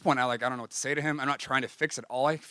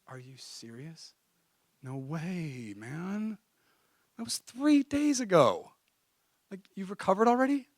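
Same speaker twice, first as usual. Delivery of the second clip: mock disbelief; 8 semitones higher, slower than usual. Shocked friend is back to normal.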